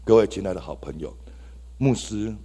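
ordinary talk, nothing marked

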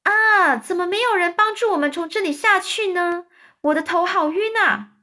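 high shrill-free lady voice calling down; lady spider voice. A narrator's reading. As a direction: play a dizzy lady spider. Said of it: "蜘蛛小姐大声问说".

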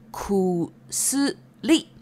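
stretched out, each syllable set apart; slow syllable-by-syllable. Shown as a demonstration. Teacher introducing the Japanese word for medicine, slow syllable-by-syllable. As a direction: say it slowly, syllable by syllable.

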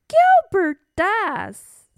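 put-on high, loud, slow child voice; little princess insisting. Princess wanting water for Gilbert, insistent.

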